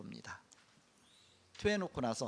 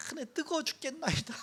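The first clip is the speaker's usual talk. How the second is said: high, whiny complaint about the heat; whining Jonah; 12 semitones higher than usual. Retold or imitated. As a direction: whimper like a sunstruck prophet. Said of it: "막 그러잖아요". (훨씬 높은 목소리로 투덜대는 요나)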